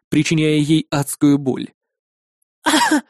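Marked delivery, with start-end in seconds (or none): 2.6-3.0 high pain cry. Acted. Spider twists leg in her arm.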